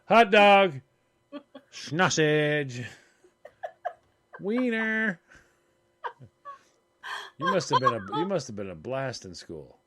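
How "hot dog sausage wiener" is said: random playful shouts; mock yelling. Picturing her wandering around yelling random words. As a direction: blurt each word out loud like a goofball. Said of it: "yelling out".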